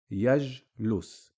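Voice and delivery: "Yajlus" with the j held short and unvoweled; Arabic word demo. Shown as a demonstration. Teacher pronounces example word for students, deliberate. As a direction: say "Yajlus" with a bare j, no vowel after it. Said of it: "We just say j".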